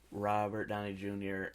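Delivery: ordinary delivery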